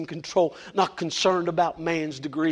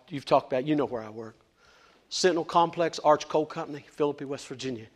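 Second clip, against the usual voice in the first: usual speaking voice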